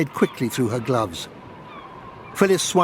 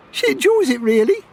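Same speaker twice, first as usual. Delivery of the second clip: giggling; 12 semitones higher, 5 dB louder than usual. Acted. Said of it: "giggled Jean".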